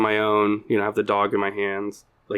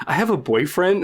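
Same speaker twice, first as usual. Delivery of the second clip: raised-pitch imitation of a rebuff; mock brush-off; 7 semitones higher than usual. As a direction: play her turning down an imagined advance. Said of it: "as if she was almost like".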